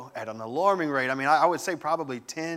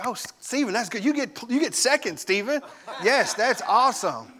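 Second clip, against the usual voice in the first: praising parent; 7 semitones higher than usual. Retold or imitated. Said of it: "And Steve would say".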